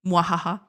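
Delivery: hammed-up villain cackle; a playful mwahaha villain laugh; evil supervillain laugh. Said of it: "That I always sound like a supervillain?".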